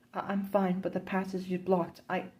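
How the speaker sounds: high, urgent voice behind the rubble; frightened young woman. Acted. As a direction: call out scared through a wall. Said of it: "you hear Adela's muffled voice".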